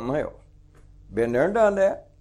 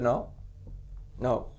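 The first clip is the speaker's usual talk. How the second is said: quiet refusal; 6 semitones lower, 12 dB quieter than usual. Retold or imitated.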